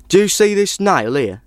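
lightly voiced storytelling traveller; traveller character. A narrator's reading. Man begins tempting the old woman.

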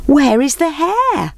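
high slow call. Acted. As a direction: call out across the clearing. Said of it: "they called out".

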